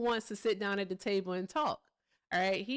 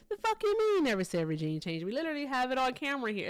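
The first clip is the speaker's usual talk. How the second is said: louder and higher, fast indignant rant; arguing with imagined Trump supporters, indignant and fast; indignant retort; 6 semitones higher, 3 dB louder, faster than usual. Real, not acted. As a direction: snap back in disbelief.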